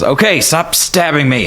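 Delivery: high, slowed-down protest; exasperated surrender. Acted. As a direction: give in with an annoyed plea. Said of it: "he goes, like".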